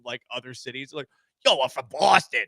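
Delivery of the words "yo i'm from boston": mock Boston accent. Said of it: "doing impressions of people from".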